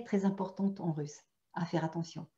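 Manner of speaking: ordinary talk, nothing marked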